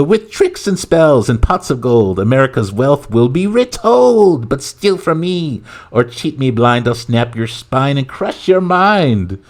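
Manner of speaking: high, slow, hammed-up Irish rhyme; leprechaun voice. Leprechaun promising riches then threatening, slow Irish chant. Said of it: "this is the leprechaun speaking".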